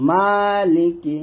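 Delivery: মালিকি with a long held first vowel; slow chanted মালিকি, first syllable lengthened; stretched MA. Shown as a demonstration. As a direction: draw out the MA of মালিকি. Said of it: "মা কে টান দিবেন".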